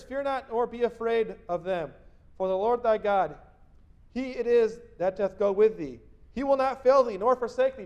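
loud, high, proclaiming Bible read; scripture reading. Acted. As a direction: declaim the verse to the congregation. Preacher reads scripture aloud.

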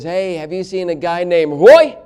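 normal then blurt Roy loud; blurted name. Retold or imitated. Stranger at the window blurting out the name Roy. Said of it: "he literally blurted it out".

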